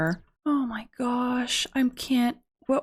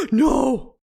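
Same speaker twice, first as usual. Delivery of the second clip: sharp gasp then a louder no; shocked gasp; 4 dB louder than usual. Real, not acted. Streamer gasps at a fatal roll, shocked. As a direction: caught off guard by the roll.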